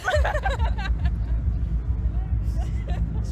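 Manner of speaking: loud, high delighted laugh; excited laughter. Real, not acted. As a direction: burst out laughing. Laughing at the event.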